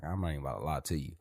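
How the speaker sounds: ordinary talk, nothing marked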